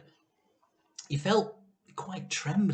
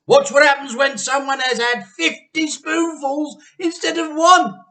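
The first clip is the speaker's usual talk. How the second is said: high, loud gloating; gleeful shout; 10 semitones higher, 11 dB louder than usual. Acted. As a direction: crow it with delight. Husband gleeful, hopping.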